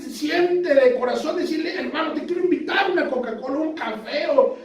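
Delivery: high, urgent pulpit exhortation; fervent preaching. Real, not acted. Preacher urges the brothers to act on the heart's prompting, fervent preaching. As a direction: preach it high and driving, stretching the last words.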